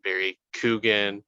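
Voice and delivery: Keoghan said as "Coogan", KOO-gun; variant pronunciation. Shown as a demonstration. Host listing ways people say the name, variant pronunciation. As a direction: say "Coogan" with an oo and hard g. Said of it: "so many different pronunciations of his name".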